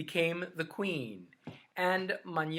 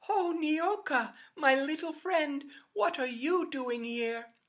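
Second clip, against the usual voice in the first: tender greeting; 8 semitones higher than usual. Acted. Greets her little snake friend.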